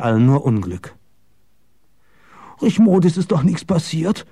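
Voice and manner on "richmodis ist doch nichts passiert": put-on high, anxious, childlike question; small high voice, begging reassurance; childlike pleading. A narrator's reading. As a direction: ask like a frightened child. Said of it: "fragte ... wie ein Kind".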